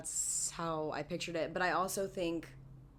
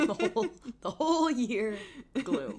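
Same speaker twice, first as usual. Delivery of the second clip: loud laughing repeat; 7 semitones higher, 6 dB louder than usual. Real, not acted. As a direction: laugh the words out. Laughing echo.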